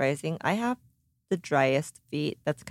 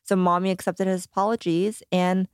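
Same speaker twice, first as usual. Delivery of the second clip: usual speaking voice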